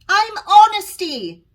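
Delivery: loud, high, slow call. A narrator's reading. Honesty calls her name after Alice. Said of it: "she calls after me".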